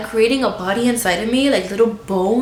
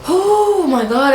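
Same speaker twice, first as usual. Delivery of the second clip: drawn-out, higher, slow exhale of the words; overwhelmed sigh; 7 semitones higher, 4 dB louder, much slower than usual. Real, not acted. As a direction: let it all out, slowly and high.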